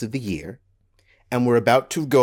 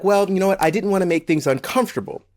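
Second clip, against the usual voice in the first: higher-pitched mock excuse; raised-pitch imitation of a lame excuse; mimicked excuse-making; 7 semitones higher, faster than usual. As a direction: imitate someone defensively excusing themselves. Mimicking people's excuse for staying silent.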